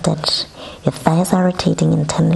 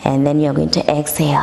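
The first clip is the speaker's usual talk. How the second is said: ocean throat breathing; faster than usual. Shown as a demonstration. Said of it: "which goes a little bit like".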